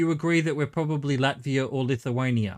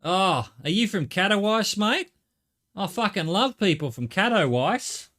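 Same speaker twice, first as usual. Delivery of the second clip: hammed-up Aussie accent, pitched up, lilting; mock Australian; 5 semitones higher than usual. Australian greeting someone from Katowice, broad mock Aussie accent. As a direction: play an over-friendly Australian local. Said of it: "in Australia it'd be".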